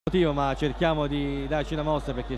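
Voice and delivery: ordinary delivery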